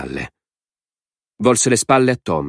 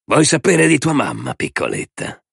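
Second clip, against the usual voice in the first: ambiguous, insinuating tone; 6 semitones higher than usual. A narrator's reading. Man taunts child about mother, insinuating. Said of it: "le chiese con tono ambiguo".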